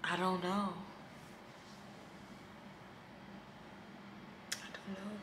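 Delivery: very soft, fading almost to nothing; lost for an answer, let the voice sink away; real quiet uncertainty. Real, not acted. At a loss, trailing into near silence.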